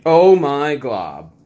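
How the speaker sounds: slow, loud groan of dismay; mock dismay. Real, not acted. Player groaning at a bad dice roll, slow and loud. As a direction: drag out the dismay.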